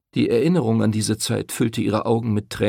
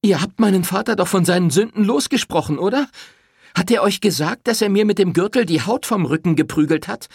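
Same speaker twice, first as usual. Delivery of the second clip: high, loud, relentless questions; furious accusation; 13 semitones higher, 5 dB louder than usual. Acted. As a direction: pile the charges on him. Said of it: "starrte den Pfarrer wütend an". (wütend anklagend)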